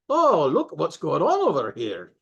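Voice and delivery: swooping Scottish puppet voice; Scottish brogue. Shown as a demonstration. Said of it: "Scottish brogue like this".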